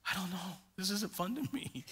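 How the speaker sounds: softened, mock winded complaint; catching breath. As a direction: lightly act out a worn-out rafter. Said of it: "catching my breath".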